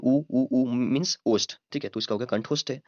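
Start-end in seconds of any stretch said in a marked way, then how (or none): 0.0-1.6 ऊ held long and slow, lips rounded, a bit louder; lip vowel demo. Shown as a demonstration. Teacher demonstrating ऊ to students, slow and rounded. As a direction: sound out ऊ ऊ ऊ slowly to show the lips at work. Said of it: "ऊ का देखिए".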